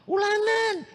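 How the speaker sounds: sharp high questioning teacher; teacher's question. Retold or imitated. Teacher in class demanding the pupil read, sharp and high. Teacher asks about the test.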